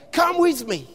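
ordinary talk

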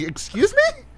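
very high squeaky "excuse me?"; offended disbelief. Retold or imitated. Host retells his reaction to the remark, squeaky offended disbelief.

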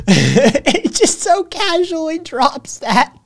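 high-pitched speech through laughter; laughing. Real, not acted. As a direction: talk while cracking up for real. Laughing at the casually dropped line.

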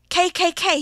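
loud, high cry of terror; shriek. A narrator's reading. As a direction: shriek each letter out, high and forceful. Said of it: "he shrieked".

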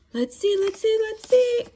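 put-on eager read-aloud to children; building excitement. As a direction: build up the suspense. Building suspense.

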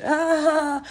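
high retold quote with laughter round it; imitating amused man. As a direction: mimic a man cracking up as he repeats it. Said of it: "And he's laughing".

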